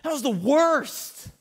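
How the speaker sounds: high, loud, slow mock complaint; mock disappointed pastor. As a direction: whine it to your wife as a joke. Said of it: "That would be like".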